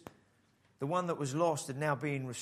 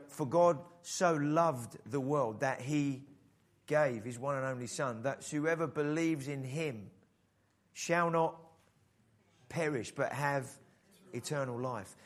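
scripture recitation; 3 semitones lower, slower than usual. Acted. Reciting John 3:16 over music.

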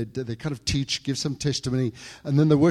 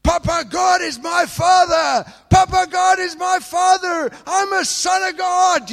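screaming at the top of his lungs; 10 dB louder than usual. Retold or imitated. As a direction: shout it to the sky, overjoyed. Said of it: "on the top of my lungs, I started screaming out".

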